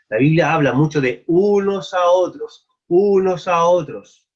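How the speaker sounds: slow, loud stress on unos a otros; emphatic preaching. Acted. Preacher to congregation, pressing "unos a otros" slow and loud.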